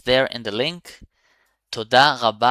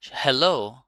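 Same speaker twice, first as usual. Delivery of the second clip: ordinary talk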